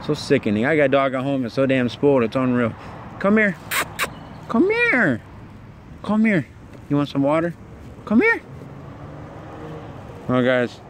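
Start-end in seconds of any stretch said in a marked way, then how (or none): 3.2-8.4 high, sing-song call to a dog; coaxing dog call. Real, not acted. Calling a starving stray dog over for water, high coaxing voice. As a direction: coax the scared dog over, pitched way up.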